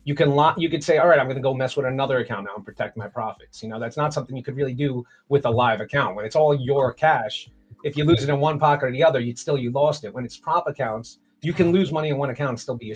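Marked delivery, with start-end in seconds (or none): none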